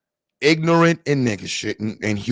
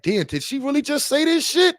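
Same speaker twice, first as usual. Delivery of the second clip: higher, in a put-on disbelieving voice; mock stunned old man; 12 semitones higher than usual. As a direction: play the shocked onlooker, eyes wide. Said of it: "Now he looking like".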